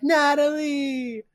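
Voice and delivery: high, drawn-out cry of her name; anguished mock yell. Fiance finds her shot on the couch, hammed-up yelling. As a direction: stretch the name out, loud and high. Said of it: "in front of her yelling".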